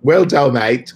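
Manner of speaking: put-on playful Jamaican British accent. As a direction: throw on a Jamaican British lilt. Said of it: "That's a Jamaican British accent".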